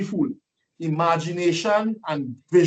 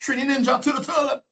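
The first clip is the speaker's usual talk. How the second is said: mocking taunt; 8 semitones higher than usual. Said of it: "They started to laugh at him and say".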